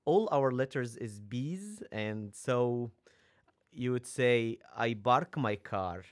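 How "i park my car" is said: "bark" drawn out slowly, B replacing P. Shown as a demonstration. Arabic speaker showing how P turns into B, "bark" for park. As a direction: say "bark" with a B where "park" has a P. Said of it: "don't have the vowel or the voice P".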